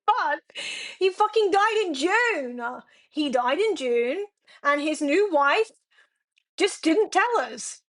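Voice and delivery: real nervous laughter through bad news; laughing in disbelief at the news. Real, not acted. Streamer laughing to chat about granddad's death.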